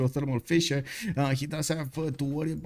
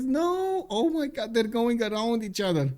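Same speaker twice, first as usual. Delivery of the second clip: sudden high no, oh my God; can't believe what's on screen; excited disbelief; 8 semitones higher than usual. Real, not acted. Caster watching two scouts pass by, high excited disbelief. Scouts miss each other.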